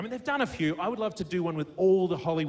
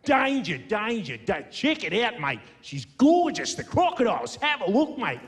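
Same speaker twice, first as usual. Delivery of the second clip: high, excited Australian crocodile hunter; hyped-up outback wildlife presenter; Steve Irwin; 4 semitones higher, 3 dB louder than usual. Acted. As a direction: exclaim in a broad, urgent Aussie accent. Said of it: "trying to do my best, Steve Irwin".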